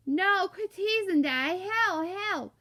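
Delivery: high, loud, garbled protest; muffled pleading wail. Acted. Stuck boy begs teasing Dad, muffled pleading wail. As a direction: wail it through stuck lips.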